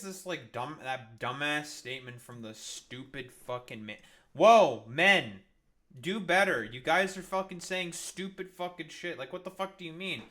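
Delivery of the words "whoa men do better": much louder, a sudden exasperated shout; loud exasperated call-out. Real, not acted. Streamer ranting at the men on the news, loud exasperated shout. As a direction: yell it out in disbelief.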